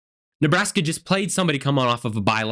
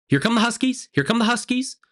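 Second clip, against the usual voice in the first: mock alarm; 8 semitones higher than usual. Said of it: "did I think".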